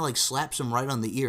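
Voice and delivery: ordinary talk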